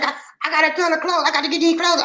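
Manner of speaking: high mock woman's voice; high-pitched quoted complaint; imitating co-host. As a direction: mimic her fretting up high. Voices absent co-host pleading chores.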